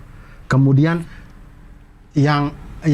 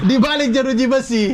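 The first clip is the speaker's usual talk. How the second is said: laughing; 10 semitones higher than usual. Real, not acted. Joke punchline laughing.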